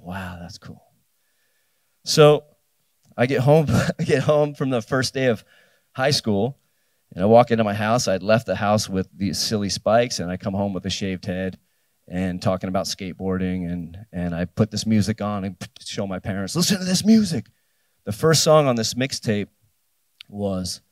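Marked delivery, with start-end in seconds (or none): 0.0-0.8 hushed awe. Retold or imitated. Awed by skateboard magazine. 16.6-17.5 excited demand. Retold or imitated. Showing parents the mixtape.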